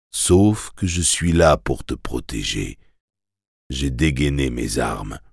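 soft, steadying hypnotist's voice; calm reassurance. Acted. Hypnotist promises to protect the terrified boy, calm and reassuring.

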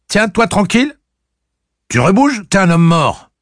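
cri aigu et fort, cinglant comme un fouet; cingle-le d'un ordre hurlé. Acted. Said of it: "le cingla comme un coup de fouet". (Cuthbert menace De Pape d'un ordre hurlé)

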